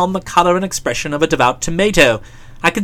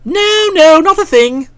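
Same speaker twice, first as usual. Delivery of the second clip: quick high denial; hasty denial; 10 semitones higher, 4 dB louder, slower than usual. Acted. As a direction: brush it off brightly. Bertie hastily denying.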